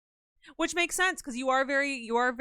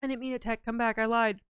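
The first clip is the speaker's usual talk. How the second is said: ordinary talk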